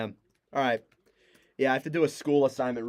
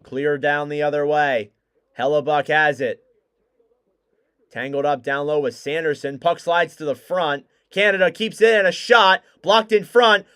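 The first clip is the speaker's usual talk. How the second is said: hockey call building to loud shouts; live play-by-play; 6 semitones higher, 10 dB louder than usual. Real, not acted. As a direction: call the rush as it happens, rising to a roar.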